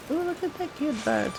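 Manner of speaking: ordinary delivery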